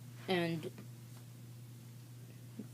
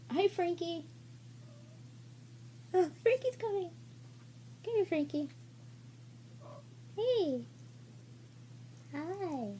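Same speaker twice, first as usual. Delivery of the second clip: excited pet greeting; 11 semitones higher, 5 dB quieter than usual. Real, not acted. Greeting Frankie the pet, high excited baby voice. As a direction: coo at the pet coming over.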